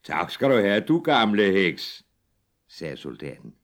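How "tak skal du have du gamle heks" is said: soldier voice. Acted. Soldier answers witch.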